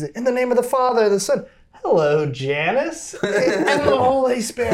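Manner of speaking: singsong mock priest with a laugh breaking in; sing-song priest blessing. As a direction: bless away cheerfully while waving hello. Priest blesses while greeting a parishioner.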